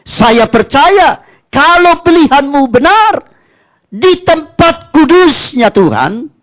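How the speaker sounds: loud, high preacher's call to the church; preaching. Acted. Pastor preaching loudly to the congregation. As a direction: cry it out like a revival preacher.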